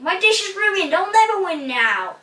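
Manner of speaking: high, distraught lament; despairing cry. A narrator's reading. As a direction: wail that all is lost. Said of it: "cried Ping".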